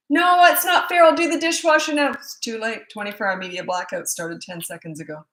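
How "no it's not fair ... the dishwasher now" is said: put-on high, loud whiny kid protest; protesting child. Punished child protests to the parent, high whiny mock plea. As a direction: play the kid begging for another chance.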